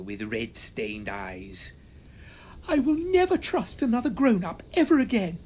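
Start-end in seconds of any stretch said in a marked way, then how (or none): 2.7-5.4 put-on high, louder wounded child's voice; a betrayed child declaring it tearfully; high-pitched boy vowing never to trust again; heartbroken boy. A narrator's reading.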